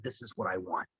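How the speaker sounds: low, hushed, to himself; quiet inner thought. Retold or imitated. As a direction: murmur it like a private realization. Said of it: "And I saw that and I said".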